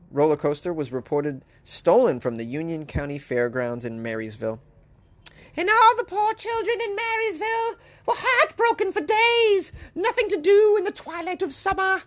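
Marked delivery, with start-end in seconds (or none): 5.6-12.0 high, wailing fake sob; mock crying. Mocking the poor Marysville children, a hammed-up sob. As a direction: wail it like a heartbroken child. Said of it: "mock sad lament for Marysville kids".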